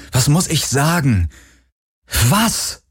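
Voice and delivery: frustrated questions climbing to a high cry; exasperated plea. A narrator's reading. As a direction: plead for an answer, last word pitched right up. Desperate questions to silent Helix.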